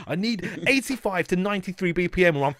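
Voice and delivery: high, loud mock ultimatum; fussy rapper insisting on the tempo; mock demand. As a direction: make a bratty ultimatum.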